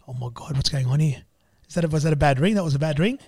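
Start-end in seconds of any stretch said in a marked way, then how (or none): none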